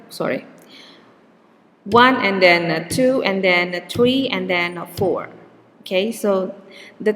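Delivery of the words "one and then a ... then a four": even beats on one, two, three, four; stress-timed rhythm. Shown as a demonstration. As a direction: tap out the stressed numbers in steady rhythm. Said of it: "in the stress term language".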